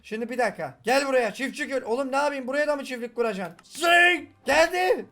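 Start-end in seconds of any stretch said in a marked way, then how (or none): 3.6-5.1 high, loud triumphant shout; excited shout. Real, not acted. Player shouting in triumph as the reward arrives, high and loud. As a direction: yell it like a win.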